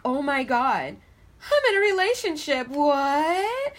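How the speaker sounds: high, loud mock shock; hammed-up disbelief, pitched high and loud; astonished realisation. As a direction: play a stunned discovery, rising into a drawn-out "what?". Acting out viewer's astonished realisation.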